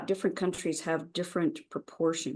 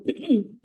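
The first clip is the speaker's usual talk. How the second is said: rough throat clear; 6 semitones higher, 4 dB louder than usual. Real, not acted. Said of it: "my morning voice".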